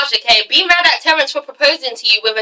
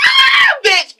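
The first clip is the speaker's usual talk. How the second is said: very high, shrieked outburst; excited scream; 4 dB louder than usual. Real, not acted. Fan overwhelmed retelling a proposal scene, excited scream. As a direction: scream it out, overcome.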